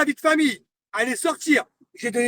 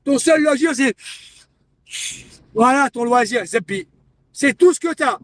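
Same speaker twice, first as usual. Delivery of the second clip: angry jabs; 2 semitones higher, 4 dB louder than usual. Real, not acted. Streamer mocking her only hobby, angry. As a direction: spit each insult out.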